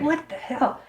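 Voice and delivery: high, baffled "what the hell"; retold disbelief. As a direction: replay the shock of finding the flowers gone. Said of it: "And I'm like".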